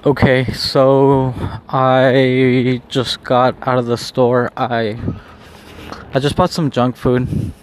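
high and spaced out; stoned. Real, not acted. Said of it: "I'm pretty high right now".

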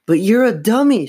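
high, loud squealed protest. A narrator's reading. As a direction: squeal it at the dummy. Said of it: "she squealed".

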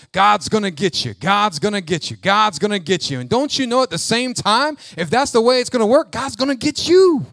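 loud hellfire chant, driving each repeat; railing. Acted. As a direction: rail like a condemning preacher. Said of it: "You wanna rail".

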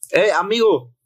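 retold hail from a stranger; calling out to a stranger. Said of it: "Así, así con el tono".

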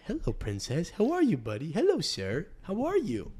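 put-on rising sweet baby talk; talking to a daughter. Shown as a demonstration. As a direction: coo like to a little girl. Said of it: "treat him like he's your daughter".

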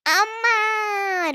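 high, loud call for mom; joyful shout. Acted. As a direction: shout for Amma as she walks in. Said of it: "Rosa shouts".

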